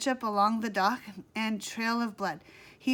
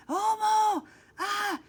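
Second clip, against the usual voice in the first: high panicked scream; 10 semitones higher, 4 dB louder, slower than usual. A narrator's reading. Sid and Monty fleeing the monster, screaming. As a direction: yell while fleeing. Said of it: "screamed Sid and Monty".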